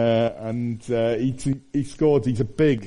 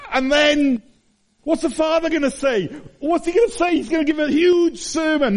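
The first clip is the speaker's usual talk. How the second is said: much higher, rising excited preaching; excited build-up; 14 semitones higher than usual. Real, not acted. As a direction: build the suspense, voice climbing high. Building to what the Father says.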